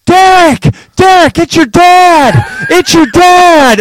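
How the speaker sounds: high, very loud drawn-out holler; imitating shouting dad. Son mimics dad banging on press box glass, hollering. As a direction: bellow it slowly as if through glass. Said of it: "and he's banging. He's like".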